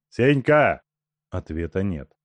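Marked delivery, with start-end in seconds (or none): 0.1-0.8 loud call, name thrown hard. Acted. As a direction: shout his name to wake him. Зовёт связанного Сеньку. (громкий окрик)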